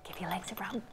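soft unvoiced whisper. Real, not acted. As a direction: hush it to the class like a secret. Teacher repeats the instruction, hushed.

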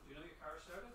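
calling from another room; off mic. Real, not acted. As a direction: speak away from the mic. Calling to someone away.

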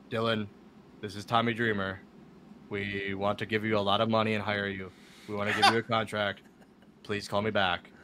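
low, even monotone voicemail; monotone Tommy Dreamer. Retold or imitated. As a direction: drone it on one low note. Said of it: "talks in such a monotone voice".

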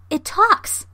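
high, loud exclamation of surprise; astonished cry. A narrator's reading. Rita hears the monkey speak, astonished cry. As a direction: cry out in amazement, pitch leaping up. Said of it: "Rita cried".

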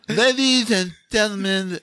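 high, very slow, pompous lisp; hammed-up Princess Bride wedding officiant; lisping movie clergyman. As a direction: draw out each word with a thick speech impediment. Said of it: "sound like the guy in, uh, uh, Princess Bride".

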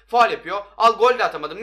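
exasperated gamer outburst; angry protest. Real, not acted. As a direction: protest the foul at full voice. Protesting a foul in a game.

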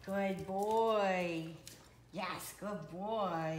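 slow, soothing dog praise; praising a dog. Real, not acted. Praising the dog after recall.

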